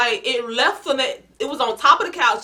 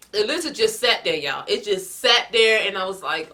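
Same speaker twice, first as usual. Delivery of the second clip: normal talking voice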